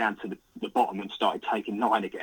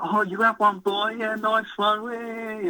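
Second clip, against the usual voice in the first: high-pitched mock-jubilant congratulations; happy cheering; 11 semitones higher, slower than usual. Friend across the lake congratulating the angler, high happy cheer. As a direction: imitate a mate whooping with delight. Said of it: "like, all happy".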